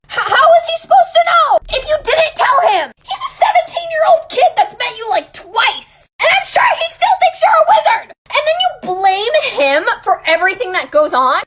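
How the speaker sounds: high-pitched shouted rant; mad. Real, not acted. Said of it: "I'm mad".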